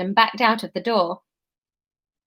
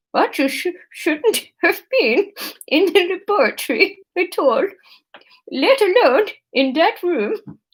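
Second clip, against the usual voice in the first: higher-pitched attempt at a Dutch accent; bad Dutch accent; 7 semitones higher than usual. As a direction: put on a clumsy Dutch accent. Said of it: "I just can't do accents".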